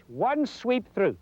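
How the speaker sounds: drawn out slowly to match the swing; slow demo. Shown as a demonstration. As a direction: stretch it over the whole sweep.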